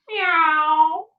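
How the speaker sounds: high rising meow; cat meow. A narrator's reading. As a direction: mew like a cat. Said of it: "The cat said".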